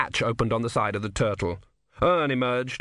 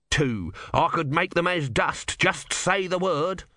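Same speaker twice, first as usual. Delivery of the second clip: mock biblical prophet; 6 semitones higher, slower than usual. God offers to destroy enemies.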